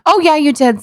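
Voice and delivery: ordinary delivery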